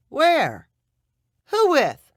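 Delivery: high, sharp demanding questions. A narrator's reading. Partner number one demanding answers from Bryn, sharp. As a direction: fire the questions at her. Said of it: "demanded partner number one".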